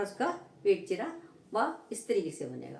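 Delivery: ordinary talk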